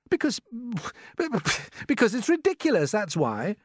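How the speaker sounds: high, halting protest; flustered boy. A narrator's reading. James arguing with grasshopper about ears, flustered and high. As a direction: stammer to a start, then insist.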